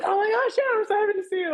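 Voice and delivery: imitating an overjoyed fan, high and loud; excited greeting. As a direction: gush like a thrilled bookseller. Bookseller greeting author excitedly.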